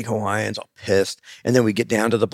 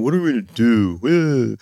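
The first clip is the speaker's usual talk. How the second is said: slow, drawn-out mock whine; retold group whine; slower than usual. Family asking dad on the road, slow mock whine. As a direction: whine it slowly like the whole carload. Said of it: "everyone's going".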